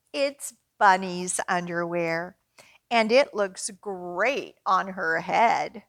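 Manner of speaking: lively picture-book read-aloud; reading to children. Acted. Librarian reading a picture book to children at story time. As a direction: read it out brightly for little kids.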